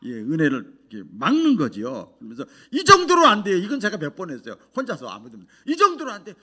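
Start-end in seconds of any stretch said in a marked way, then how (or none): 2.7-3.6 high, loud cry of no; shouting refusal. Retold or imitated. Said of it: "소리치면서". 5.7-6.3 shouting refusal. Retold or imitated. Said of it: "소리치면서".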